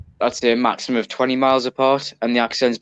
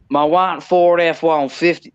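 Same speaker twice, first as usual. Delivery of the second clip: slow, higher mock American accent, words drawn out; American accent impression; 5 semitones higher, slower than usual. British guest mocking an American accent to his co-host. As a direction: butcher an American accent on purpose, slowly. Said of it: "I'm really gonna butcher the American accent".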